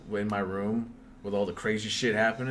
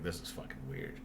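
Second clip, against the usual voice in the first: muttered aside; 6 semitones lower, 11 dB quieter than usual. Retold or imitated. Thinking to himself.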